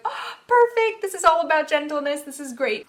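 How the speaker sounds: bright, high and loud burst of delight; thrilled to find the perfect trick; excited. Retold or imitated. Mom recalling finding the heartbeat hug, thrilled and loud. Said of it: "I was like".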